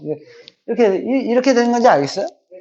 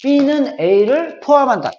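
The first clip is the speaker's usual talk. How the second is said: very slow, raised, word by word; slow reading aloud; 7 semitones higher, much slower than usual. Shown as a demonstration. Teacher to students, reading the subset symbol, slow and raised. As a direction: read it out like a model sentence. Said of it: "얘를 기준으로 읽을 때는".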